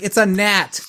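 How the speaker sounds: a touch of weary disbelief; exasperated. Retold or imitated. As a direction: deflate the drama. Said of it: "I'm like".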